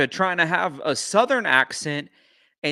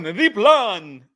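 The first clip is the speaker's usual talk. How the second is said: louder, hammed-up mock of a strange accent on "The plan"; mocking odd accent; 3 semitones higher, 6 dB louder than usual. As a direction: parrot her put-on accent with a scoff. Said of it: "What the heck accent is that one?".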